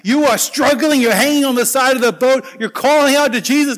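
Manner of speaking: ordinary delivery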